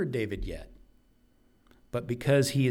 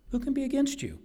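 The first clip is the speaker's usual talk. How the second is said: much higher, ringing rhetorical question; preacher's defiant high-pitched challenge; soaring rhetorical question; much faster than usual. Acted. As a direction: lift the question high in triumph.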